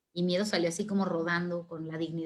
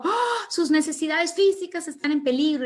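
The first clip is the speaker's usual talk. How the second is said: acted alarm, louder and higher; alarmed gasp; 8 semitones higher, 5 dB louder than usual. Fear spots danger, raises alarm.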